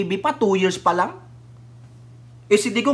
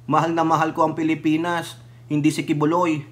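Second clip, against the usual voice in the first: mock voice of God; 5 semitones lower, 4 dB quieter than usual. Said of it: "sabi ng Diyos".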